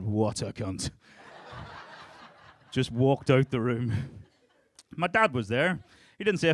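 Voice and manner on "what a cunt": flat, disgusted brother's verdict; a hint of the brother, said through a laugh; deadpan brother. Brother staring him down, a lightly voiced disgusted verdict.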